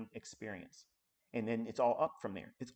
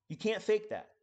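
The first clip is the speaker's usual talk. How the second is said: higher and louder repeat; emphatic; 7 semitones higher, 4 dB louder than usual. Real, not acted. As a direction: punch it harder.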